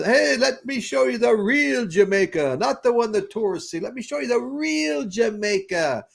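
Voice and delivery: high, slow salesman pitch; hustling local guide. Retold or imitated. Bartender pitching a tour to tourists, high and slow. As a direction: sell the real Jamaica to tourists.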